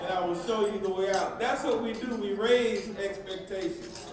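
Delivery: high, loud, rousing delivery; impassioned preaching. Real, not acted. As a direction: drive the moral home to a cheering crowd. Speaker drives home the moral.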